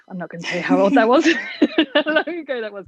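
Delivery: coy refusal breaking into laughter; laughing. Real, not acted. Coach refusing to date herself, laughing. Joking refusal to reveal her age.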